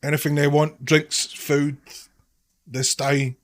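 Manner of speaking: loud, high Cockney barman call; Cockney accent. Acted. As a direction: shout the orders in Cockney. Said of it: "doing my dreadful Cockney accent".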